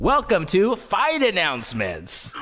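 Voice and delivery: slow, high announcer voice; drawn-out announcer intro. Pretend. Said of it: "I'll say fight announcement".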